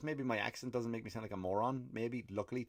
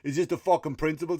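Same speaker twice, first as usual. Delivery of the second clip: louder, higher mock heckle; acted-out jeer for his friends; emboldened heckling; 6 semitones higher, 7 dB louder than usual. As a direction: play the cocky passenger showing off. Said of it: "they get braver again".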